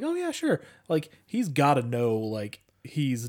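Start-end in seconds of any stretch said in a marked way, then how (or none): 0.0-0.6 high, eager mock yes-man reply; eager pushover. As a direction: chirp it like a clueless guy. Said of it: "And he's like".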